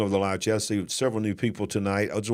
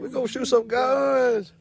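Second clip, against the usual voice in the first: excited echo; 13 semitones higher than usual. Real, not acted. Echoing daughter's shout in the clip.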